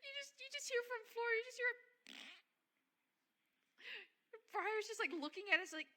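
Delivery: very high, loud, excited telling. Real, not acted. Excited laughing narration.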